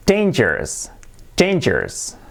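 dangerous as DEIN-jers; pronunciación correcta. Shown as a demonstration. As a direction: say "dangerous" with a long ei. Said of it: "y no, es". (dangerous bien dicho, 'déin-yers')

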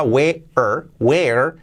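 "where" with a strong, clear final R; well-marked R. Shown as a demonstration. Teacher repeats for learners, "where" with R well marked. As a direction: mark the R at the end of "where". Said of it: "con la R bien marcada".

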